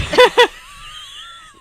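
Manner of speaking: very high, loud shriek of laughter. Real, not acted. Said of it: "So loud".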